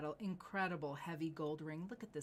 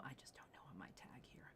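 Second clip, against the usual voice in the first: whispered aside; 5 semitones lower, 16 dB quieter, faster than usual. Real, not acted. Host checking tag aside.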